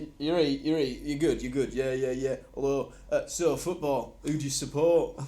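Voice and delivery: lightly imitated northern accent; Craig's Stoke accent. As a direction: put on a chummy Stoke small-talk voice. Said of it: "what, Craig's accent?".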